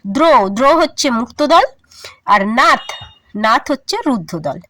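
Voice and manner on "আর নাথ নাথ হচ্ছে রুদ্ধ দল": normal talking voice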